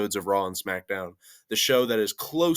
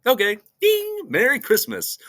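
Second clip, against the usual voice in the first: very high, loud squeaky voice; Cesaro impression; 13 semitones higher, 6 dB louder than usual. Vlogger mimicking Cesaro's greeting, high and squeaky. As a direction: squeal it out cheerfully. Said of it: "He's like".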